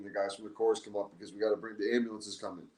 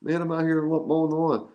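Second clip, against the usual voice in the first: higher, louder cocky retort; brushing it off; 7 semitones higher, 5 dB louder than usual. Retold or imitated. Friend insisting he'll play Sunday, cocky higher retort. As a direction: wave off the worry like a tough guy.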